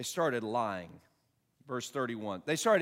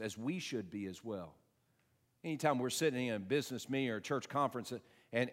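ordinary talk

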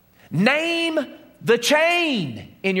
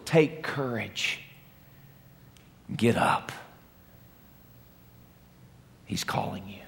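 gentle encouragement; 10 semitones lower, 8 dB quieter than usual. Acted. Crowd urging blind Bartimaeus to get up, hushed whisper. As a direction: coax him softly, fading into a whisper.

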